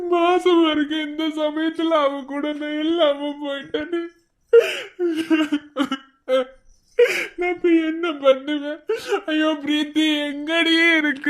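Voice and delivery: tearful, sobbing lament; crying. Acted. Man calling for his missing pregnant Preethi, crying. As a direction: wail through sobs for a missing loved one. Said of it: "ப்ளீஸ்ண்ணா, அழாதீங்க".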